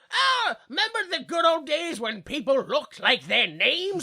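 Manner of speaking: mock someone pining for old days; nostalgic old-timer. Old-timer recalls when people looked like their names, mock nostalgia.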